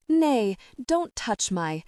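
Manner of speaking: usual speaking voice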